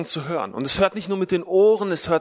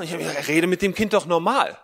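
ordinary talk

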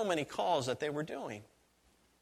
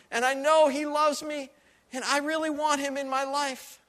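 high, tearful pleading voice, much higher; tearful woman; 11 semitones higher, 4 dB louder than usual. Retold or imitated. Woman bursting into tears on the porch, high tearful pleading. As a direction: retell her crying confession in a high, broken voice. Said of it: "she burst out crying".

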